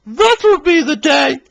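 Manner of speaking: high, slow, mocking jeer; laughing Daisy. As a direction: scoff it slowly, way up high. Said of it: "laughed Daisy".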